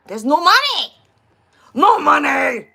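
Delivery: loud, high imitated outburst; exasperated owner's shout. Owner to the customer with worthless cans, imitated exasperated shout. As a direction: burst out after the muttering.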